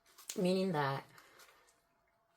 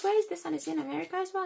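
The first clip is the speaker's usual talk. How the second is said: high, loud rapid questions; raised-voice, incredulous demand; retold stunned interrogation; 11 semitones higher, 7 dB louder, much faster than usual. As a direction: act out firing shocked questions. Retold interrogation about the son.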